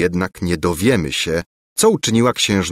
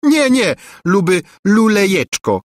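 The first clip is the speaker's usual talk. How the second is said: high, louder mocking sing-song; spiteful mimicry; 10 semitones higher, 4 dB louder than usual. A narrator's reading. Angelika spitefully mocks Lulejka, high and teasing. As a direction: tease him spitefully, pitched way up. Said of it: "przedrzeźniała go złośliwie".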